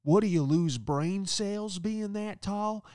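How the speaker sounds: high, slow mocking woman's voice; roasting lady. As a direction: sneer at him like a rude old guest. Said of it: "she goes".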